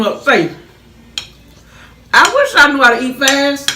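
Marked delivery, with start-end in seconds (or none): 2.1-3.7 excited. Real, not acted. Excited about the food.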